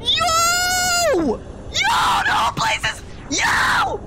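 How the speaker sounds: high startled shouts; startled yelling. Real, not acted. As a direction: yell out in alarm. Startled calling out in game.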